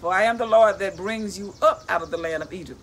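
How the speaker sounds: loud, proclaiming Bible reading; declaiming scripture from the book. Acted. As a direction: read the verse out like a preacher. Reads scripture aloud.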